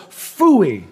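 imitated toddler refusal, punched then falling; playful impression of a sassy child; a little attitude. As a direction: swoop down through a mock-disgusted "phooey". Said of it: "with a little bit of attitude".

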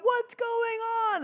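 high, far-off shout of a startled neighbor; neighbor yelling. Retold or imitated. Neighbor shouting from next door over the raccoon fight, alarmed yell. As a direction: call out over the fence, alarmed and slow.

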